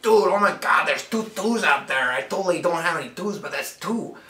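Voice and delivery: a hyped-up surfer dude spotting the twos; El Guapo voice. Acted. El Guapo shouting to his partner about twos, excited dude. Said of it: "Guapo over here's like".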